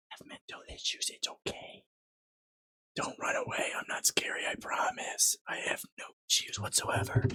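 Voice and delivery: hushed, voiceless whisper; mock-reassuring whisper. Streamer jokingly tells viewers not to run away, whispering. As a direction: whisper it like coaxing someone not to flee.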